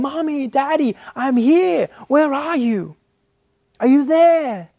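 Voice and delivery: put-on high, loud child crying out for his parents; lost little boy shouting to be found. Lost child calling out for mummy and daddy, high and loud. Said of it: "you raise your voice this time".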